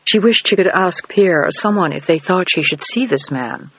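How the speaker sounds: plain reading voice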